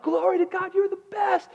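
high-pitched elated cry; jubilant praise. Retold or imitated. Husband throwing the pen away, jubilant praise. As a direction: exult to God, voice way up. Husband celebrating news of lungs.

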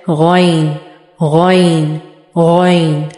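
गैन said thick and full from the top of the throat; a deep, heavy गैन, repeated; heavy ghain demo. Shown as a demonstration. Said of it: "गैन की आवाज़ तो simple सी है".

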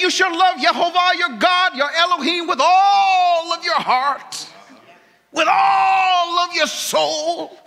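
loud, high, slowing proclamation; proclaimed commandment. Acted. Preacher proclaiming Yeshua's greatest commandment, loud and slow. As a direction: preach each phrase out, stretching it.

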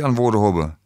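ordinary delivery, nothing marked

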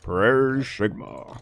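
slow, drawn-out mock battle cry; mimicking game unit. Player parroting the game unit's cry, mock-zealous. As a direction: stretch it out like a zealous soldier.

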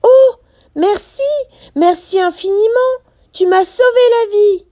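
high, relieved thanks; grateful relief. Acted. Rescued character thanks his saviour.

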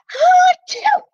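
high, loud hammed sneeze; loud fake sneeze. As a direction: sneeze like a little pig. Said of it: "Pop sneezes".

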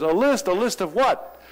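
lightly voiced nurse questioning; puzzled nurse. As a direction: nurse questioning the woman, mild surprise. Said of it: "The lady says".

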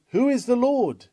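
high, loud scornful challenge; defiant demand. Acted. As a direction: fling the question back defiantly. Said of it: "they demand".

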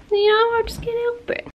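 dog voice. Acted. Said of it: "my dog voice".

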